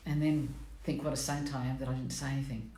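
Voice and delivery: ordinary delivery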